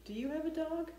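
ordinary talk, nothing marked

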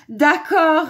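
high, loud, cheerful okay; amused agreement. A narrator's reading. Wolf agreeing to do dishes, high amused voice. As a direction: agree loudly with mild amusement. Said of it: "répondit en riant le méchant, méchant loup".

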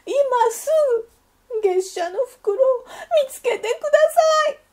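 high, loud, fast tearful wail; wailing child. A narrator's reading. Duckling in bed demanding her mother find the envelope, wailing. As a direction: bawl the demand through sobs. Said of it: "わーわー泣きわめきました".

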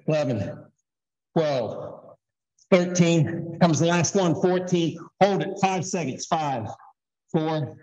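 ordinary talk, nothing marked

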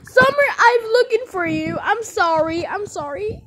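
high, loud pretend cry of relief; distraught calling. Parent finding lost Summer, acted distraught high cry. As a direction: call out high, upset and sorry.

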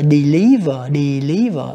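slow model of "deliver", stressing LIV; second-syllable stress. Shown as a demonstration. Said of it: "âm hai". ("deliver" nhấn âm hai, đọc chậm)

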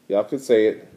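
ordinary delivery, nothing marked

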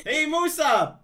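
usual speaking voice

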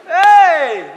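sudden loud "Hey!"; loud shout. Acted. Preacher jolts the congregation with a loud shout. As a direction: bark it to snap them awake.

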